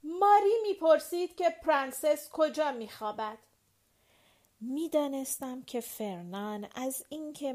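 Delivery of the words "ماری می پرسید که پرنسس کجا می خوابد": a touch louder and higher, annoyed; mildly raised, grumbling shout; displeased shout. A narrator's reading. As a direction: call out, fed up with the question. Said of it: "با لحن ناراضی فریاد زد".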